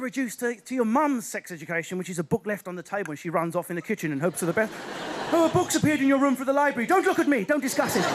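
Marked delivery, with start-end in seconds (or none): 5.3-8.0 loud, high, rushed mum voice; hammed-up panicking mum; flustered mum. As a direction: blurt it out like an embarrassed mother. Voices embarrassed mum fleeing.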